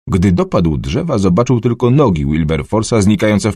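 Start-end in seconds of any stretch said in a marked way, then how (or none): none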